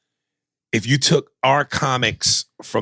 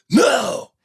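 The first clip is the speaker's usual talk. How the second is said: much higher, drawn-out denial; arguing passenger; 13 semitones higher, 3 dB louder than usual. Acted. Back-seat passenger denies farting, high mock protest. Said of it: "the guys are arguing in the back".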